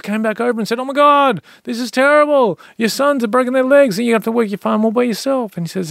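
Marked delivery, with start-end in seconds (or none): 0.8-5.6 high, loud dismay; alarmed neighbours. Retold or imitated. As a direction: wail the bad news like a neighbour. Neighbours lament broken legs.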